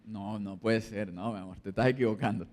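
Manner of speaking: husband denying in a low pleading voice; acted denial. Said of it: "Negación".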